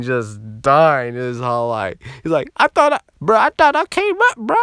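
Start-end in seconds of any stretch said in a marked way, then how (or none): 2.6-4.6 very high, loud squeal of a voice; hammed-up distraught bro; imitating whining friend. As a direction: wail it in mock disappointment. Whining friend imitated after the scolding.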